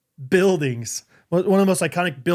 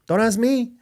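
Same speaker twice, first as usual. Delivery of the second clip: put-on Spanish accent; 7 semitones higher, slower than usual. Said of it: "That's just a Spanish accent".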